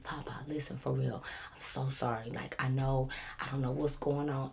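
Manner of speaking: hushed, low apologetic plea; soft, low, earnest apology; calm and collected. Retold or imitated. Pleading for a friend with the officer, calm and hushed. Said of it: "I try to be the calm, cool, collective one".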